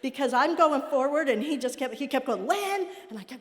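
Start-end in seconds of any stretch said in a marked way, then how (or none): 2.5-2.9 calling out. Retold or imitated. Husband calling out from horse.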